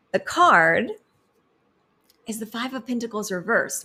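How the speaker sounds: suspenseful tarot-card announcement; dramatic reveal. Acted.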